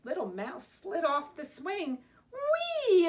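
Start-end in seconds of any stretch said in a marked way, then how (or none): 2.3-3.1 high, loud, drawn-out squeal of fun; gleeful whee. A narrator's reading. Little Mouse sliding off the swing, gleeful whee. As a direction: let it fly high and loud.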